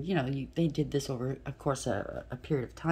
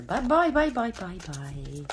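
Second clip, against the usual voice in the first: sing-song bye, high and loud, then slowing and fading; mocking goodbye; 3 semitones higher, 4 dB louder, slower than usual. Reader waving off the people who are leaving, mocking goodbye. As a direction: wave them off with taunting glee.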